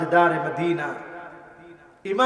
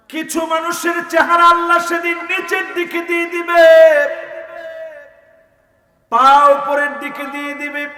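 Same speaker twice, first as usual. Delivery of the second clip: high, sustained sermon chant; impassioned chanting preacher; melodic preaching; 10 semitones higher, 7 dB louder than usual. Real, not acted. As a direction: intone each phrase high and let it ring.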